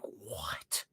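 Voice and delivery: breathless whispered what; stunned. Retold or imitated. Player after the ending twist, whispered disbelief. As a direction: mouth it in frozen shock. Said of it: "I was like".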